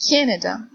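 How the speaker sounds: Canada with the stress on "Ca"; Canada, strong "Ca" and weak ending; stress on first syllable. Shown as a demonstration. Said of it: "is in the first syllable".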